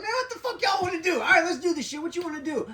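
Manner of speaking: high-pitched, fired-up confrontation; retold heated challenge. Acts out challenge again, jacket off.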